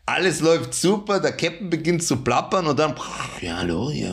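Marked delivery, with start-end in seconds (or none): 3.4-4.1 mumbled pilot announcement. Retold or imitated. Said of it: "er macht da so eine Stimme, die ist nicht klar".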